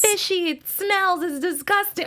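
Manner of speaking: high, disgusted mock complaint; disgusted Mary. Friend imitates Mary complaining, high and disgusted. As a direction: mimic a picky chef sniffing bad fish. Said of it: "She was like".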